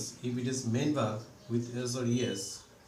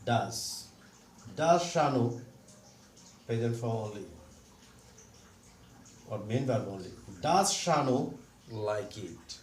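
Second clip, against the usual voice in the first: ordinary delivery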